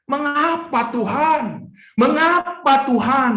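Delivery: high, slow, pleading cry to God; anguished cry. Acted. Job crying out to God in anguish, high and slow. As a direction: draw out each plea, aching.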